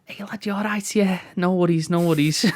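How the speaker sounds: high, light comic character voice; chirpy imagined character. Acted. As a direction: put on a high, matey greeting voice. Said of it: "and he goes".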